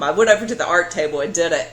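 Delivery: ordinary delivery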